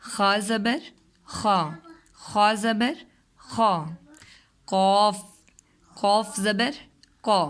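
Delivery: slow, sung-out letter drill with rising زبر; phonics drill. Shown as a demonstration. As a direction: spell each letter out for a child, then say the sound. Teacher drills letter sounds. (آہستہ آہستہ حروف کی مشق)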